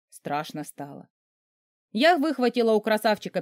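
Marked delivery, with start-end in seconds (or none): 0.1-1.1 тихо, испуганно, почти про себя; сбавить голос до испуганного шёпота; страшно. A narrator's reading. Испуг героини. (тихо и испуганно)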